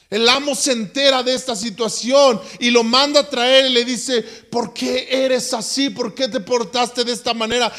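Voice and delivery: high, loud preacher storytelling; fervent preaching. Acted. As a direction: preach the parable with rising fire. Preaching at full force.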